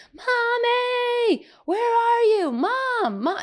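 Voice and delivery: high, loud wail for mom; persistent calling child. Retold or imitated. Eli keeps calling mom downstairs, persistent child's yell. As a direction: imitate a small boy yelling out, stretching the words. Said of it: "he's pretty persistent".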